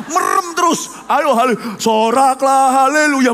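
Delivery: loud high call, soraklah stretched slowly; rally the congregation; worship leader shout. Retold or imitated. Young worship leader calling the congregation to shout, loud rallying call.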